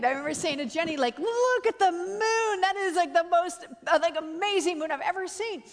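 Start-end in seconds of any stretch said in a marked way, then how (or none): 1.2-5.6 awestruck exclamation. Retold or imitated. Retold awe at the red moon.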